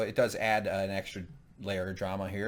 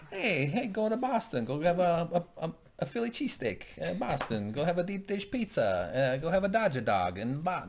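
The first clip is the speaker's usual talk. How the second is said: put-on jokey Boston accent, pitched higher and cocky; hammed-up Boston accent calling out orders; mock Boston accent; 6 semitones higher than usual. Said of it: "that's my Boston accent".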